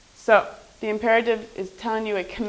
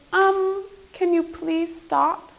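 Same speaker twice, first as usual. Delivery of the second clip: hesitant, slow, higher-pitched polite plea; overly polite request; 6 semitones higher, slower than usual. Shown as a demonstration. Teacher shows how not to warn someone, overly polite request. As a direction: ask timidly, drawing it out. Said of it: "You wouldn't say".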